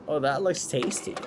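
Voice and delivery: normal talking voice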